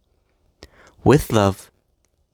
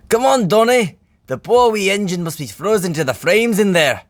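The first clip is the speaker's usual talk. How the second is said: high, lively Scottish twin voice; chuckling Douglas; 10 semitones higher, 3 dB louder than usual. A narrator's reading. Douglas to Donald, rescuing a frozen engine, jolly. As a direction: urge your twin on in a higher character voice. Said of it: "chuckled Douglas".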